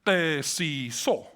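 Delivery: Kesiso said very slowly, syllable by syllable; Hebrew pronunciation. Shown as a demonstration. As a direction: spell out Kesiso slowly with every vowel. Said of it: "If a Hebrew person would say".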